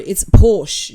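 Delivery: Porsche as one drawn-out syllable, "porsh". Shown as a demonstration. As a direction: say Porsche slowly with no final vowel. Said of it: "we call it Porsche".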